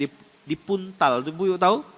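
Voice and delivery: ordinary talk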